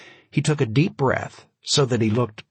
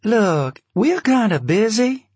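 overly patient, higher voice; exaggerated patience; 11 semitones higher, 4 dB louder, slower than usual. A narrator's reading. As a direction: sound patient, clearly put on. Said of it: "exaggerated Patience".

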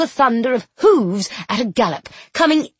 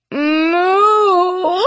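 high, loud mooing cry; 8 semitones higher, 4 dB louder than usual. Acted. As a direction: call out a long high moo. Mooing to the cow.

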